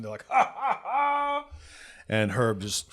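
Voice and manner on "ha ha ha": high, put-on laugh; mocking group laughter. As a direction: fake a gang's jeering laugh. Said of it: "everybody starts laughing".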